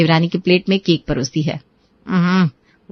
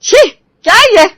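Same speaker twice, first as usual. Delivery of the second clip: disgusted outburst; 10 semitones higher, 5 dB louder than usual. Acted. Mother-in-law disgusted by breakfast.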